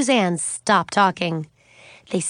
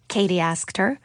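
ordinary delivery, nothing marked

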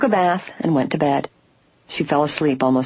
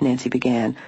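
usual speaking voice

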